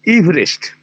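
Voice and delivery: ইভরেস্ট said ibrest, two syllables with no middle vowel; ইভরেস্ট with the first syllable ib and no a sound in the middle; Everest's own pronunciation. Shown as a demonstration. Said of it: "নামের উচ্চারণটা হচ্ছে".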